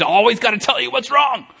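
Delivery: mock-exasperated rant, pitch climbing high at the end; venting hothead. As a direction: play a fuming venter, voice rising. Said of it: "the venti guy that has smoke coming out of his ears".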